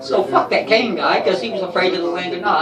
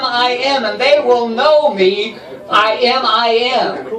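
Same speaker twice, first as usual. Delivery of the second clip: grandiose, loud divine proclamation, pitched up; booming God voice; 4 semitones higher, 4 dB louder, slower than usual. Acted. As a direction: play God announcing himself with pomp. Comic plays God proclaiming himself.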